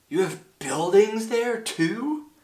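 high, slow, astonished question; gasped amazement. A narrator's reading. Horton hears of buildings on the speck, amazed. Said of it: "Horton gasped".

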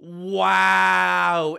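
long, loud, drawn-out exclamation; amazed wow. Real, not acted. Gamer amazed at still having ten hearts, amazed wow. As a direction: hold the word out loud in amazement.

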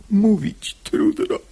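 voiceless, faltering whisper; a feigned heartbroken whisper; breathless whisper. Acted. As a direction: whisper as if too pained to speak. Pious man feigns heartbreak.

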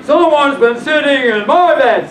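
emphatic storybook Father Bear; great big father bear voice. Acted. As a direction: boom it out like papa bear. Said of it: "in his great big voice".

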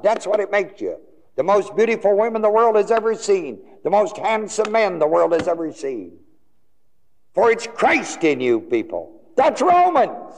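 high, rousing pulpit proclamation; impassioned preaching. Real, not acted. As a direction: proclaim it to the congregation with rising fervor. Preacher exhorting congregation.

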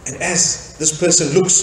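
ordinary talk, nothing marked

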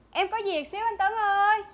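high, loud, stretched-out call. Acted. Woman asks Tấn for help, calling out loudly.

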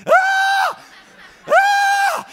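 piercing high shriek of shock from icy water; scream. Retold or imitated. Retelling his friend's plunge, full scream. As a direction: let out a sudden high yell like hitting freezing water. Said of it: "He's just screaming".

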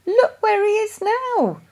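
high alarmed exclamation. A narrator's reading. Mother spots dog at beehives.

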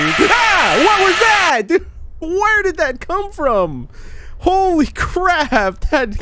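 very high, startled cries of shock; jump-scare shriek. Real, not acted. Player jolted by a sudden noise, shrieking in fright. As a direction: yelp and keep asking what it was.

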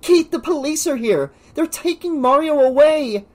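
put-on high girl's shout into his ear; high-pitched alarmed yell; loud, urgent. A narrator's reading. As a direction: shout urgent news to rouse a dazed friend. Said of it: "loud and close to his ear".

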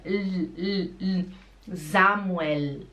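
tongue-to-palate L closing "Samuel". Shown as a demonstration. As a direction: isolate the L, then end "Samuel" on it. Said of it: "O L é no céu da boca".

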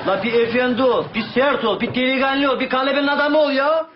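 exasperated Karadeniz scolding. Acted.

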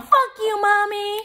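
high-pitched mock bratty kid yell.

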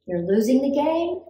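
ordinary delivery, nothing marked

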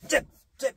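short crisp 잽 cut off fast; punchy staccato call; quick jab. Shown as a demonstration. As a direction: jab and pull back. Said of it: "이건데요".

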